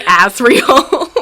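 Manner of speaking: Azriel said ASS-reel, laughing; Azriel like ASS-riel. Shown as a demonstration. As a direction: exaggerate the short A in Azriel. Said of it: "sounds too much like Azriel".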